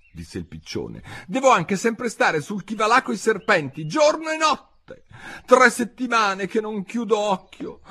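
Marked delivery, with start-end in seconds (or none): 1.3-7.8 very high, loud complaining; put-upon bird, high and loud; aggrieved pigeon. A narrator's reading. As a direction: pile up the woes in a high voice. Pigeon's grievance.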